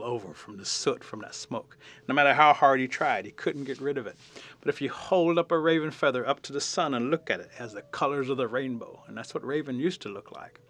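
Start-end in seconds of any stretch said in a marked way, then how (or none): none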